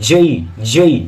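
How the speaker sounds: জেই as gliding "jay-ee"; correct pronunciation. Shown as a demonstration. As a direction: end জেই on a clear i. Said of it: "সঠিক উচ্চারণটা কি?". (জেই-র সঠিক উচ্চারণ, টেনে)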